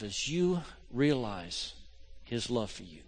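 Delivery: hushed, intimate sermon voice; tender, prayerful. Acted. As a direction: invite them to feel it quietly. Slow intimate prayerful passage.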